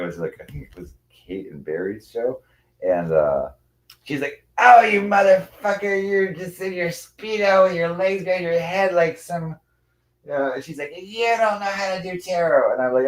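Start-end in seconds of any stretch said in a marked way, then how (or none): 4.6-9.6 high, loud, drawn-out mimicked screech; mock angry rant. Streamer imitating an unpleasant woman's insults, mock angry rant. 10.9-12.7 high, slow mocking imitation; mock her, pitched way up and drawn out; mimicked sneering accusation. Streamer imitating a woman doubting tarot skills, high mocking voice.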